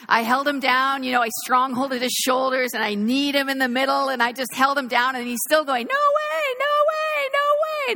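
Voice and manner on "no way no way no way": very high squealing no way; screaming toddler. Retold or imitated. Pinned-down toddler refusing medicine, high squealing protest. Toddler resisting being held down.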